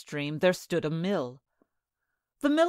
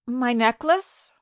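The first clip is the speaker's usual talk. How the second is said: maiden; 5 semitones higher, 4 dB louder, slower than usual. A narrator's reading. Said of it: "replied the maiden".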